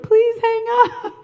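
high, loud, laughing plea; half-laughing, desperate please; exasperated plea. Retold or imitated. Begging a coworker to hang up, high and laughing. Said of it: "I was like".

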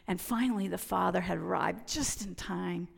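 ordinary talk, nothing marked

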